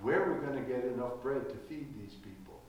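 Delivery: the Lord whispering the question into our ears; whispered into ears. Retold or imitated. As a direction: whisper it into someone's ear. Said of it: "leaning over and whispering into our ears".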